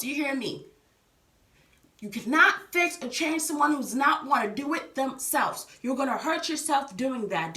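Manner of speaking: usual speaking voice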